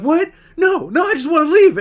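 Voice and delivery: high-pitched insistent denial; hammed-up pleading protest; mock protest. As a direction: imitate a friend whining to go. Friend protests the accusation.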